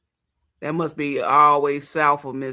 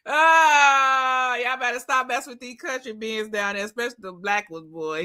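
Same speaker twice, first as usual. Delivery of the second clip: higher, hollering country uncle; country relative voice; 6 semitones higher than usual. Retold or imitated. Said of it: "Sound like them country motherfuckers".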